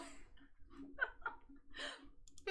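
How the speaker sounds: silent wheezing laugh; air-only giggle, no voice; breathy laugh. Real, not acted. Laughing at the name.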